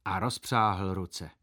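ordinary delivery

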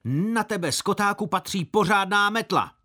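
put-on high, loud old woman's scolding, building up; scolding market woman. Old fruit seller scolding a boy who scared her, high and loud. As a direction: spit out the scolding at the brat. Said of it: "A odplivla si".

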